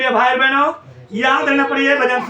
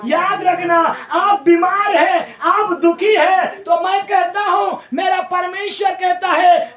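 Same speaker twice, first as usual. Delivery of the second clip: fiery preaching; 6 semitones higher than usual. Acted. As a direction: preach it with full force, lifting the crowd. Preacher at full force.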